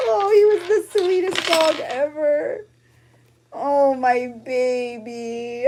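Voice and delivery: remembering Barley, laughing and tearing up at once; laughing through tears. Real, not acted. Showing Barley's photo to viewers, laughing through tears. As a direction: laugh that breaks into crying, high and loud.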